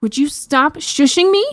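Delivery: high, exasperated demand; indignant princess, lightly screamed; mock scream. A narrator's reading. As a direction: demand irritably, pitch rising. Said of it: "Jazz screamed".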